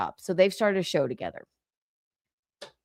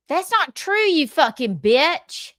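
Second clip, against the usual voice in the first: high, drawn-out insult in mock voice; higher mocking voice; 8 semitones higher, 4 dB louder, slower than usual. Repeating the quote in a high mock voice for the group. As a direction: squeak it out high then drag it slowly. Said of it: "Any other impressions?".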